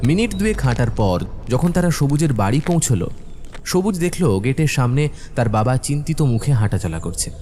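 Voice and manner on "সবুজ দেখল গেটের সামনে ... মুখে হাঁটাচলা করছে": usual speaking voice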